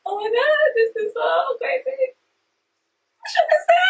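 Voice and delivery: very high-pitched, overwhelmed squeal; overwhelmed with excitement. Real, not acted. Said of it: "I'm fucking excited".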